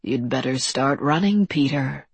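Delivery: low, understated threat; cold menace. A narrator's reading. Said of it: "Harker looked down coldly".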